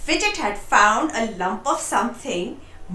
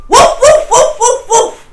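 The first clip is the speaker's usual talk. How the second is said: much higher and louder barks; fierce barking; 11 semitones higher, 11 dB louder than usual. A narrator's reading. Sheepdog barking loudly to frighten the wolf. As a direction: bark hard to scare off a wolf. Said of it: "went Fidget".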